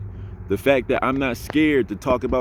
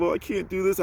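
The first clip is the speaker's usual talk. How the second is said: mock whiny self-doubt; 6 semitones higher, 4 dB quieter than usual. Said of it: "downgrading yourself".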